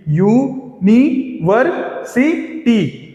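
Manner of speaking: University split slowly as यू, नि, वर, सि, टी; every beat of university set apart, mostly rising; syllable by syllable. Shown as a demonstration. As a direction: count out university with a gap after each syllable. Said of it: "इसमें चार syllable हैं।".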